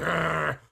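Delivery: little hobbit growl. Retold or imitated. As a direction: growl like a mad little gremlin. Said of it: "like a little, like, hobbit".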